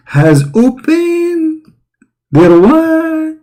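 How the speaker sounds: squeaky falsetto, drawn out slowly; high mock squeal.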